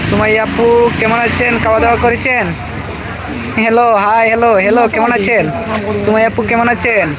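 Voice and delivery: ordinary delivery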